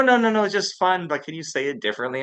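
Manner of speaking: high, quick placating protest; mock-reassuring, high-pitched and rushed; retold hasty reassurance. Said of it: "they go".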